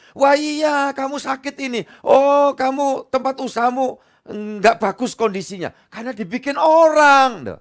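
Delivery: mock fortune-teller pastor. Pastor mocks preachers who play psychic, hammed-up seer voice. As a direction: ham up a pastor who claims to read people. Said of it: "ngomongnya gini".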